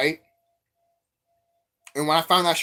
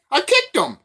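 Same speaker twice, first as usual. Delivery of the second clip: I KICKED him much higher and louder; triumphant yelled repeat; excited repeat; 14 semitones higher, 5 dB louder than usual. Retold or imitated. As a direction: shout the kick triumphantly.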